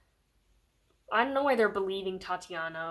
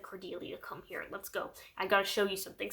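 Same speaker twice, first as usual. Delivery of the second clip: hushed, much softer urgent whisper; secret whisper; 6 dB quieter than usual. Retold or imitated. Christopher sneaking Cordelia out of the meeting: hushed whisper. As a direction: whisper it so the meeting won't hear.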